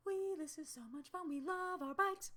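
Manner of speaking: high, sing-song mock-cheerful voice; carefree cyclist. Imitating herself riding happily before the attack, high sing-song.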